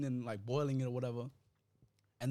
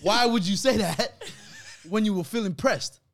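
exasperated protest; 5 semitones higher, 7 dB louder than usual. Real, not acted. Storyteller questioning the absent roommate, loud laughing exasperation. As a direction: demand an answer through a laugh.

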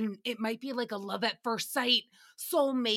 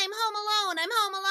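put-on high, whiny kid voice; mock little kid; 10 semitones higher, faster than usual. Mocking the child star in a high kid voice. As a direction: squeak it like a little boy. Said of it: "little Macaulay Culkin".

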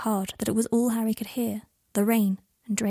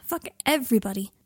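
ordinary delivery, nothing marked

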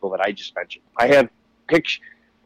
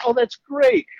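fake enthusiasm; 14 semitones higher, 4 dB louder, slower than usual. Feigned enthusiasm, never met her.

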